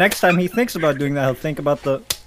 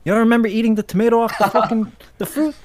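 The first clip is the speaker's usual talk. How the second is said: high, loud mock disbelief; raised-voice parental questioning, hammed up; incredulous parent; 8 semitones higher, 5 dB louder than usual. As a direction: imitate a parent pressing in disbelief.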